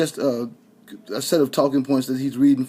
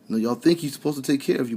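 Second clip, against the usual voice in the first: ordinary talk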